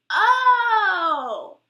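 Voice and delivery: loud, high drawn-out "oh"; long theatrical falling "oh"; mock "oh". Embarrassing a boyfriend before friends, theatrical loud cry.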